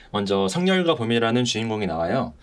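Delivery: ordinary talk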